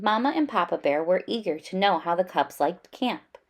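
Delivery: plain reading voice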